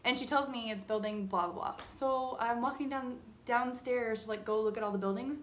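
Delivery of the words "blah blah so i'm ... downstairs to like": usual speaking voice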